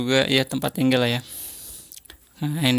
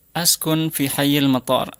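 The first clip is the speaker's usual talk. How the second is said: usual speaking voice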